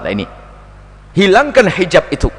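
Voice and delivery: normal talking voice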